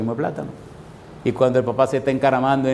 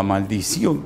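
father's curse; 5 semitones lower, slower than usual. Retold or imitated. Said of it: "Dice el papá".

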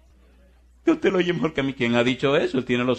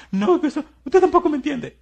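put-on much higher, louder girlish whine; whiny girl protest; 14 semitones higher, 7 dB louder than usual. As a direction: squeal it like a teen girl. (chica quejándose, aguda y fuerte)

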